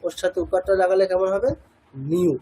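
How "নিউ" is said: নিউ said as a single glide; demonstrated pronunciation. Shown as a demonstration. Teacher repeating নিউ for students, clear. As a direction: model নিউ for the class. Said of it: "এরকম।".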